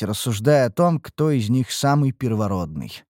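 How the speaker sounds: ordinary delivery, nothing marked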